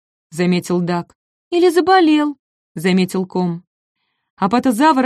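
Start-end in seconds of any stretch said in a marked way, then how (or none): 1.5-2.4 high, concerned guess; worried little dinosaur. Acted. Ком встревоженно. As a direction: suggest it anxiously.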